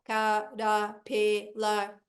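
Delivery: "caterpillar" like a robot, syllable by syllable. Shown as a demonstration. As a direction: chop CATERPILLAR into four mechanical beats. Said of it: "se você falar igual robô".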